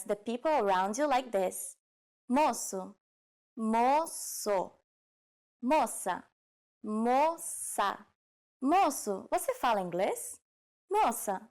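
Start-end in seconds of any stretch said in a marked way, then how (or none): 3.6-4.7 "Moço" drawn out in two syllables; slow demonstration. Shown as a demonstration. Teacher showing learners the word for boy, slowly. 6.8-8.0 "Moça" drawn out in two syllables; slow demonstration. Shown as a demonstration. Teacher showing learners the word for girl, slowly.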